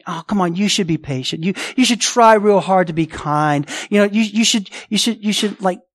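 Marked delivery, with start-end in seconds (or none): none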